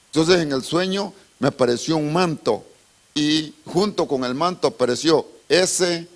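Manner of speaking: usual speaking voice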